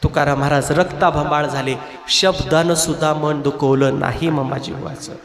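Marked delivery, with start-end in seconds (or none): none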